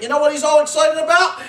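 ordinary talk

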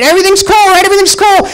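high strained fake reassurance; fake calm. Husband telling his wife all is well, strained high fake calm. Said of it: "I'm saying".

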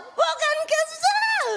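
high sobbing shout, slow; crying child. Pretend. Child crying back at mother, loud high sob. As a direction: howl it through tears.

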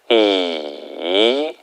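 "이" low and dipping; third tone. Shown as a demonstration. Teacher drilling third tone with students. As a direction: sink "이" low. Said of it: "삼성".

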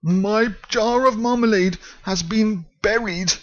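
high, upset bear's cry; exclaimed. Acted. Paddington tells the foreman his marmalade is buried, high dismayed cry. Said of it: "exclaimed Paddington".

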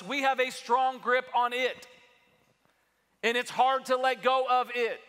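rising sermon intensity; preaching at full force. Acted. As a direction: drive the point home to the church.

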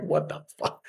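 whispered disbelief under the breath; inner whisper. Retold or imitated. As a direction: mutter it so nobody in class hears. Inner reaction during silly exercise.

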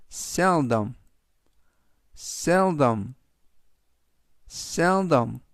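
seldom, said carefully as SEL-dom; teacher modelling seldom; model pronunciation. Shown as a demonstration. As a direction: say seldom clearly for repetition. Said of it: "이라고 발음하시면 되겠습니다".

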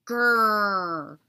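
low growling grrr; dog growl. A narrator's reading. Spelling a dog's growl.

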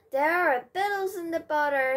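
cry. A narrator's reading. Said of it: "he cried".

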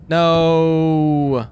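long, loud drawn-out "no"; dismayed cry. Real, not acted. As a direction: cry out in protest, holding the vowel. Dreading what the game shows.

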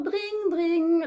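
high, sustained "dring" ringing; imitated phone ring. Storyteller sounds the phone for children, imitated ringing. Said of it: "le téléphone se remit à sonner".

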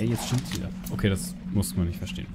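ordinary talk

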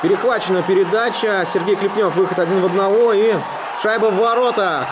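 excited hockey goal call. Real, not acted. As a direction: build fast into the goal shout. Goal scored, rising commentary.

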